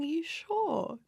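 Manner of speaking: a soft, high-pitched mock query from mum; doubting mum. Mum questioning her daughter in labour, lightly doubting. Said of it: "my mum was going".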